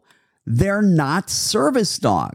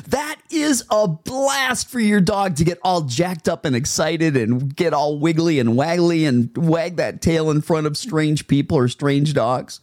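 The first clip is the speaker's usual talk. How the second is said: normal talking voice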